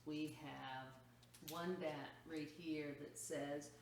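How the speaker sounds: usual speaking voice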